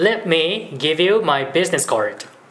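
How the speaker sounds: very slow, careful repeat for practice; slow model phrase. Shown as a demonstration. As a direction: lead the drill, slowly. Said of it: "じゃあ行ってみましょう".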